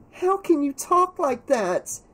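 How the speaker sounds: put-on high, upset girl's reproach; high-pitched indignant demand. A narrator's reading. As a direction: confront a friend in a high, distressed voice. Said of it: "Carrie demanded of Mario".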